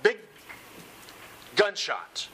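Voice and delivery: normal talking voice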